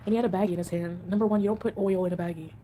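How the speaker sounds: ordinary talk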